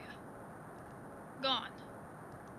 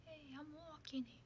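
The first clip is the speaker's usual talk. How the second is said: New Yorker accent in a whisper to find it; whispered New Yorker line; 19 dB quieter than usual. Shown as a demonstration. Warming up the New Yorker accent, whispered. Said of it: "how to do the accent".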